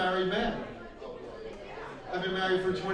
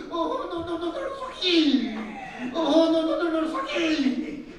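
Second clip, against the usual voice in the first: high mock panicked shriek of no; hammed-up squeal at the missed putt; mock scream; 7 semitones higher than usual. Wife squealing at the golf putt, high mock panic. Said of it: "I screamed".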